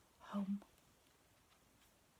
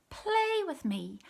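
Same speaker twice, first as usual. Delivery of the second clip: pleading kitten; 9 semitones higher, 4 dB louder, slower than usual. A narrator's reading.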